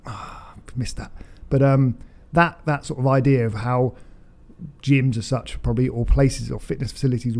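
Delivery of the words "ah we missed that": breathy whispered regret; hushed disappointed sigh of words; rueful whisper. Retold or imitated. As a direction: whisper it like a quiet letdown. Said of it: "made it, we're like".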